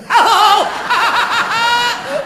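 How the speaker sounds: a big, high hooting laugh at his own punchline; loud high cackle. Real, not acted. Comic laughing loudly at his own joke, a high cackle. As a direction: throw your head back and cackle.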